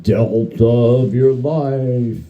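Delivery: slow, drawn-out mocking of the dad lecture. Ghost mocking the narrator's dad line, slow and drawn out. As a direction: stretch each word out to mock the dad. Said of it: "he says".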